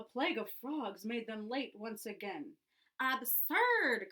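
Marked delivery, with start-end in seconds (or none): 3.0-4.1 loud, scornful cry; indignant teacher crying out; cried out. A narrator's reading. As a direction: exclaim it as nonsense. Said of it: "cried their teacher".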